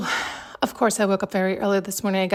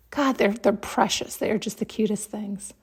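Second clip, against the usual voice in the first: ordinary talk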